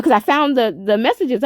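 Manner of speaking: ordinary delivery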